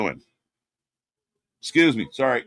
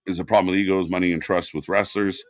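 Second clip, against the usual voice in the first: ordinary delivery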